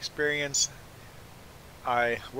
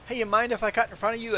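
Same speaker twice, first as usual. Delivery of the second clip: mock breezy request, high and quick; imitated queue-jumper, quick higher voice; imitating pushy line cutter; 10 semitones higher, faster than usual. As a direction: rush it out high, like a presumptuous customer.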